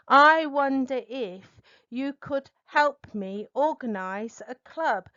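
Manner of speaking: "I wonder if" lifted and loud, then level; a clear hit on "I wonder if", rest even; stress on "I wonder if". Shown as a demonstration. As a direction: lean on "I wonder if" to flag a question. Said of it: "be very aware of the English phrase, "I wonder if".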